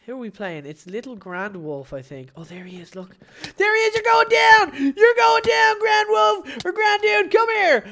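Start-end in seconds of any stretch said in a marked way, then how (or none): 3.6-7.8 high, loud battle taunt; taunting shout. Real, not acted. Gamer yelling at his duel opponent, high and loud. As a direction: yell it at the enemy like you're about to win.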